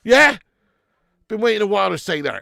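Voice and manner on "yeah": loud mocking jibe at a rival fan; gloating taunt. Real, not acted. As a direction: rub it in, loud and cocky.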